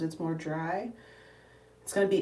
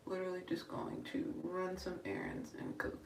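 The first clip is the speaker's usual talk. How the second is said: whisper; 11 dB quieter than usual. Real, not acted. Whispered aside.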